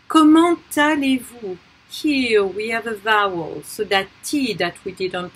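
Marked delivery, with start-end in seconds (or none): 0.1-1.6 Comment allez-vous high and loud, T linked into allez; emphatic model of Comment allez-vous with linking T; liaison T. Shown as a demonstration. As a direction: belt out Comment allez-vous as "ko-mahn-tah-lay-voo". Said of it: "is pronounced as a liaison".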